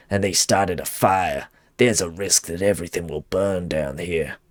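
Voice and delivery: low, subdued character read; weary boss's note voice. Acted. Boss writing to Nils about the damaged depot, low in-character voice.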